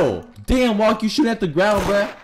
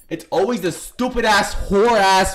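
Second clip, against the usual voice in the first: ordinary delivery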